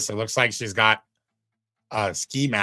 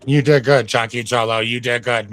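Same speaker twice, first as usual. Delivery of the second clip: louder, hearty praise for a friend; 2 semitones higher, 8 dB louder than usual. Real, not acted. Streamer cheering his co-host on loudly. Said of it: "Tell me I did a good job".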